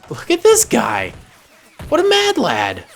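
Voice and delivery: high, loud and amused at the daredevil; excited cheer. Real, not acted. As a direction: hype up the mad lad, laughing at him. Commentator cheering a reckless soldier.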